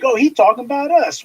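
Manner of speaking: hammed-up gullible "Oh, he's talking about us."; mock naive listener. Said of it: "meant to make you think".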